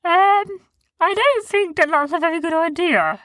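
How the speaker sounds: high, piping voice of a big furry creature; Sniz's high voice. A narrator's reading. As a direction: pipe up high and timid. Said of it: "Sniz piped in".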